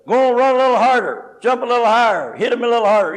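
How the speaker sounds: loud, high sideline cheering; mock cheerleader. Preacher mocking a sideline cheerleader, loud high cheer. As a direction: egg the player on like a cheerleader from the sidelines. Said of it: "it's easy to be a cheerleader".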